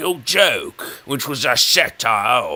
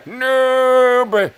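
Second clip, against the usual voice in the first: laughing; 10 semitones higher, 9 dB louder than usual. Real, not acted. Laughing self-mockery.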